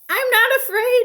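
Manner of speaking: squeaky high, shaky insistence; frightened Toad insisting he's brave; high scared cry. Acted. Toad, shaking, cries he is not afraid. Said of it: "he cried".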